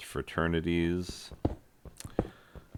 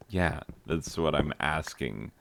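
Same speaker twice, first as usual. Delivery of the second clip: normal talking voice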